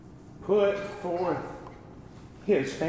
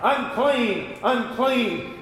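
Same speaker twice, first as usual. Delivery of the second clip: leper's warning cry; 8 semitones higher, much slower than usual. Retold or imitated. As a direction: call it out so people scatter. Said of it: "he had to holler out".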